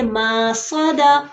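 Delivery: "मसौदा" with a long first vowel; wrongly lengthened opening. Shown as a demonstration. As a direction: stretch the "म" of "मसौदा" too far. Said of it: "या इस तरीके से नहीं है".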